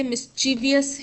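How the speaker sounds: "मिस्चीवियस" with an extra i before the last syllable; common mispronunciation. Retold or imitated. Teacher mimicking how people misread the word, mispronounced with extra syllable. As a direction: say "मिस्चीवियस", ending vi-yus. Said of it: "क्योंकि लोग पढ़ते".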